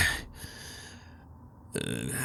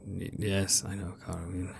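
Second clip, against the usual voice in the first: whispered; 3 semitones lower, 12 dB quieter than usual. Acted. Father's hushed reply.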